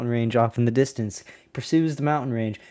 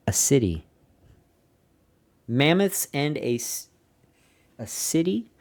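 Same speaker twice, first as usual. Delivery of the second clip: incredulous. Real, not acted. Incredulous reaction to diary.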